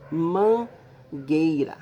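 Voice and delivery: slow, split into syllables: man-guei-ra. Shown as a demonstration. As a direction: draw mangueira out slowly, one syllable at a time. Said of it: "tem três sílabas". (mangueira bem pausada, sílaba por sílaba)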